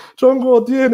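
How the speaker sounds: nearly yelling it to make them get it; exaggerated Chinese accent. Sweaty foreigner begging the crowd for a converter, hammed-up Mandarin. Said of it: "我这个口音是夸张的".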